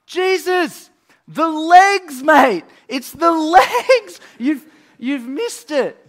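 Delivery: high, loud laughing yell, Jesus the legs mate; yelling down from the roof. Retold or imitated. Friends on roof yell down to Jesus, loud and laughing. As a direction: shout down through the hole, half laughing. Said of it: "they're yelling down".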